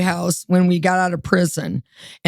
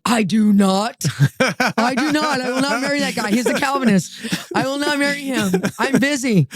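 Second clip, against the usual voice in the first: raised pitch, emphatic mock protest; indignant refusal; 4 semitones higher than usual. Woman refusing God's message to marry, emphatic retold protest. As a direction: dig your heels in, pitched up. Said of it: "And I said".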